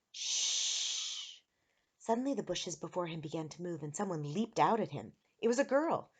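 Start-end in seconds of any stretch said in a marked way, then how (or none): 0.1-1.4 long drawn "shh"; hushing shush. A narrator's reading. Hidden girl hushes Eric, long shush. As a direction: silence someone urgently.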